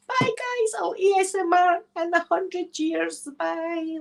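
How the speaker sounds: very high, drawn-out farewell; playful falsetto-like send-off; high singsong goodbye. Pretend. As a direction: call bye as if vanishing into the mist. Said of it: "like that".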